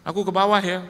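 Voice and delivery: wife calling down that she is heading downstairs. Retold or imitated. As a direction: shout it down the stairs. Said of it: "dia udah teriak".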